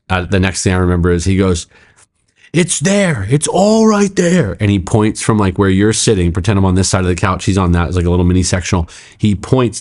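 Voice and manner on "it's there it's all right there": high-pitched imitated yell, drawn out; retold yell. Pastor retelling the man's outburst at the couch, high imitated yell. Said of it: "and he just yells".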